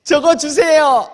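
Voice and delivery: put-on loud, high, drawn-out child's demand; demanding five-year-old. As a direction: beg like a small kid wanting a toy. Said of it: "다섯 살짜리 애기 같습니다".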